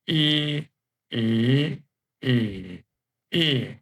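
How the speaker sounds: e level, rising, dipping, then falling; e in four tones. Shown as a demonstration. Teacher reading the row aloud, e through four tones. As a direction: run e through all four tones in turn. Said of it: "pay the attention, uh, to the tones".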